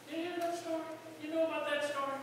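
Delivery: ordinary talk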